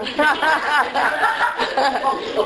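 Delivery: long, falling chuckle; amused laugh. Real, not acted. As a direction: laugh at your own joke. Laughs after joke about slow reading. (讲完笑话后的笑声)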